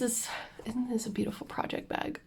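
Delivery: quick admiring whisper. Real, not acted. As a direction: breathe it out in a whisper. Showing off project bag.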